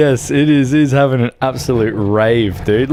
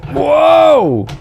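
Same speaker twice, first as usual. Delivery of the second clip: surprised shout; 14 semitones higher, 6 dB louder than usual. Real, not acted. Truck flies.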